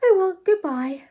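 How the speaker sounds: high, airy dismissal; vague tone. A narrator's reading. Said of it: "said Ethel in a vague tone".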